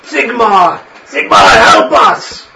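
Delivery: loud, high, slow cry to Sigmar; an old priest begging his god; terrified old priest. Acted. Priest sees chaos moon.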